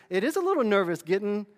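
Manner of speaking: usual speaking voice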